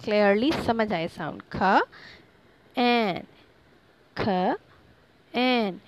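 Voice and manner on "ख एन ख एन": dragged sounding-out. Shown as a demonstration. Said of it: "थोड़ा सा drag करके पढ़ा".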